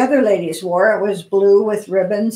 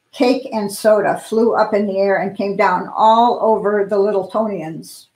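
usual speaking voice

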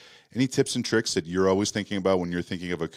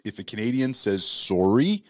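ordinary delivery

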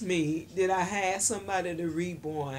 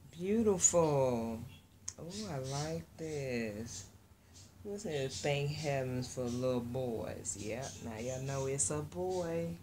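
normal talking voice

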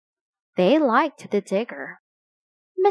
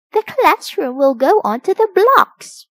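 lightly voiced teacher, higher and louder; teacher announcing; 9 semitones higher, 6 dB louder than usual. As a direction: raise your voice to tell the class. Teacher explains to the children.

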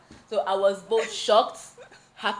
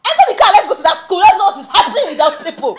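loud, high, fast imitation of a fired-up friend; indignant friend; 7 semitones higher, 12 dB louder, faster than usual. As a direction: take charge in a rapid, raised voice. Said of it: "She's like".

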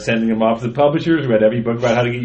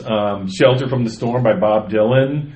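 ordinary talk

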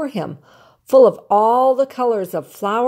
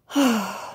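sigh; 2 semitones higher than usual. A narrator's reading. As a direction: let out a long envious sigh. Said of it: "He sighed".

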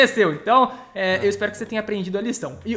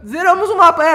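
excited; 9 semitones higher, 8 dB louder than usual. Real, not acted. Finishing the map.

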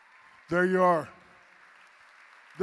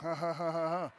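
fake laugh; 6 dB quieter than usual. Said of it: "Oh, nice little joke".

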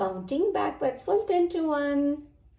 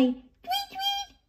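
high chirping tweet; bird tweets; 3 dB quieter than usual. A narrator's reading. George imitating birds in the sky, high chirps. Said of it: "he said".

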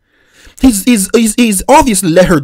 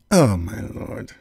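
a slow, low, quiet "Oh, my Lord"; exasperated groan; 11 semitones lower, 10 dB quieter, much slower than usual. Real, not acted. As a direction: drag it out low in disbelief. Exasperated at clip.

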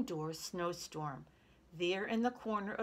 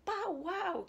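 put-on high toddler exclamation; crying-out baby; 12 semitones higher than usual. A narrator's reading. Baby Louie sees the puppy, crying out. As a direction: pipe up high like an excited baby. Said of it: "cried baby Louie".

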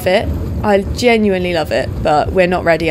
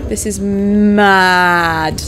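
very slow, stretched words; slow amazed drawl; drawn-out awe; much slower than usual. Pretend. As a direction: stretch every word in wonder.

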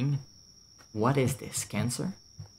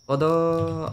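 mock French accent, higher, rolling the French R in Rodo; 6 semitones higher, 4 dB louder than usual. Calling to Rodo in a teasing mock French accent. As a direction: tease your friend with a hammed-up French accent. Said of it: "French accent".

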